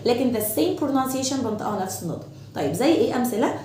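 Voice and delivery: usual speaking voice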